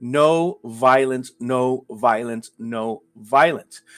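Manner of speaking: slow, deliberate, each word weighed; slow emphatic repetition. Real, not acted. Streamer to his live chat, slow and deliberate. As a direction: spell it out slowly for emphasis.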